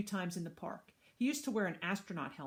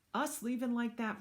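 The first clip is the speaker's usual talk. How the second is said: usual speaking voice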